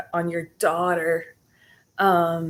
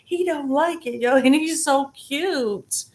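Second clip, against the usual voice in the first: cooing; 6 semitones higher than usual. Real, not acted. Cooing over kitten.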